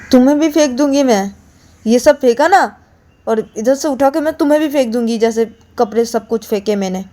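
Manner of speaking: angry threat. Acted. Payal threatens to throw Ananya off the bed, angrily. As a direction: threaten to throw her out too.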